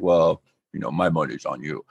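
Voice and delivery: lightly voiced Worf, low and quiet; a hint of Worf's low rumble; Worf impression. Co-host retelling Worf's line to Troi, low Worf voice. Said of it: "That was a pretty good Worf".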